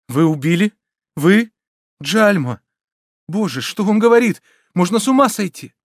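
high, loud, alarmed questions; growing fright. A narrator's reading. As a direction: exclaim in mounting panic. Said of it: "Всё более и более пугаясь".

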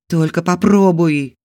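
wife pinned down, shouting a warning. A narrator's reading. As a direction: shout a threat at him. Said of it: "предупреждающе выкрикнула".

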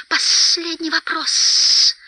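high, slow creature hiss; sibilant, drawn-out echo; Gollum's echo. A narrator's reading. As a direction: repeat it high with long hissed s sounds. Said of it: "повторил Голлум".

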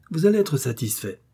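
usual speaking voice

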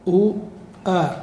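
normal talking voice

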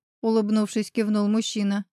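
ordinary delivery, nothing marked

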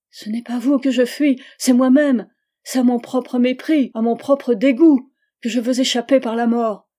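plus haut et bien plus fort, à vif; dégoût de soi éclatant. Acted. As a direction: cracher son propre mépris. Aveu désespéré de Charlotte. (Charlotte avoue à Georges sa honte, voix forte et brisée)

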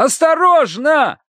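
very high, drawn-out cry; warning shout. A narrator's reading. Narrator warns Terence climbing aboard, warning shout. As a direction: shout out in alarm. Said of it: "крикнул я".

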